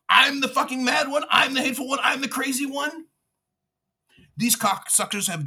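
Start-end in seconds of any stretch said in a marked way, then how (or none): 0.1-3.0 higher, louder bitter rant; real angry raised voice; furious sarcasm. Real, not acted. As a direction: throw their labels back at them in anger.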